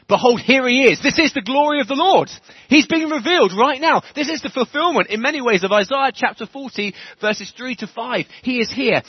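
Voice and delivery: loud, high proclamation, every line pushed out; full-force preaching. Acted. Preacher announcing to the church that the Lord is here, shouting high and loud. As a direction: proclaim it to the whole hall like a revival preacher.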